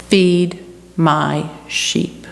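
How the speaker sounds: very slow, deliberate command; measured timing. Acted. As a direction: let each word land slowly. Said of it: "With his own exquisite timing".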